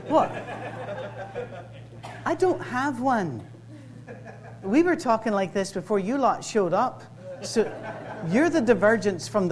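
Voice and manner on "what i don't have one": slow, higher-pitched protest; playfully affronted, slow denial; mock indignation. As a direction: deny it indignantly, drawing out the words. Mock-indignant reply about his accent.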